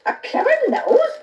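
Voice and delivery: the mother mocks Jack's story; mother hissing. A narrator's reading. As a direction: sneer it back in disbelief. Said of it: "his mother hissed".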